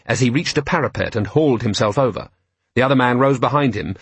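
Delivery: ordinary delivery, nothing marked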